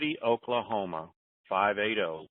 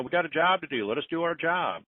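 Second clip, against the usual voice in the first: pitched up, protesting the stop-start; mock indignant workers; 6 semitones higher than usual. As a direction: imitate the workers demanding to get on with it. Quoting disgruntled workers' demand.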